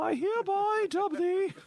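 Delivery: very high, slow, hammed-up royal English accent; over-the-top posh Queen's English; mock Queen's accent. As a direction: play a pompous English queen knighting someone. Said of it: "Can you demonstrate a Queen's accent".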